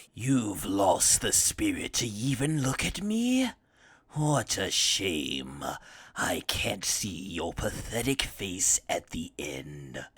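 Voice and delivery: low, slow, cold villain sneer fading to a whisper; Frieza's sneer. Retold or imitated. Frieza to kneeling Jiren, cold sneering whisper. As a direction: sneer down at him, then whisper the insult. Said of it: "And he says".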